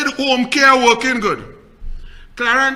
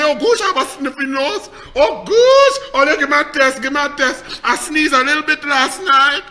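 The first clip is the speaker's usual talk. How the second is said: loud, high hammed-up fretting; mock panic; 4 semitones higher, 6 dB louder than usual. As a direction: wail over a tiny sniffle in mockery. Mimics him panicking over a sniffle.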